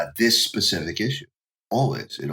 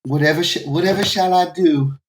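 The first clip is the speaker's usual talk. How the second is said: mock Southern damsel, pitched way up; Southern belle; 9 semitones higher, 6 dB louder than usual. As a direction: tease with a hammed-up Southern accent. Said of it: "The Southern Belle".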